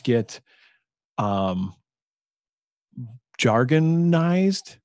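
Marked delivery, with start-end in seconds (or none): 3.4-4.8 jargonized stretched very slowly, slightly higher; drawn-out word. Shown as a demonstration.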